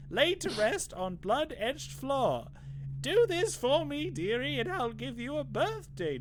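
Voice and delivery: put-on high, slow old crone voice; Granny Rags voice. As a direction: creak it out like a hag reading a spell. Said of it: "the Granny Rags voice".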